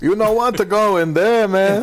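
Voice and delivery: high, loud mock barrio accent; Ichiro. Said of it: "you sound like you're from the barrio".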